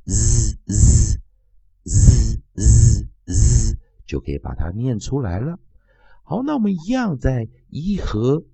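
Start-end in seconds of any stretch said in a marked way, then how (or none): 0.1-3.8 Z repeated loud; Z sound demo. Shown as a demonstration. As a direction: model Z for students to copy. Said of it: "只要念前面的Z".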